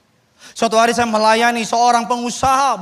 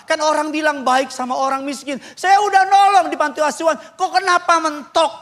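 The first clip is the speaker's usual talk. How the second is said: pleading complaint, higher pitched; retold protest; 8 semitones higher than usual. Businessman asks the pastor why he is stuck, in retold protest. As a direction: imitate a frustrated man protesting.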